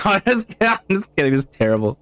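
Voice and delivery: high-pitched, laughing backtrack. Real, not acted. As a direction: laugh it off, voice jumping up. Laughs off own bad accent attempt.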